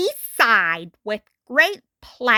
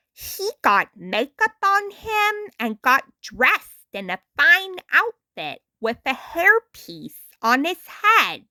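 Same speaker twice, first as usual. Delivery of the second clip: ordinary delivery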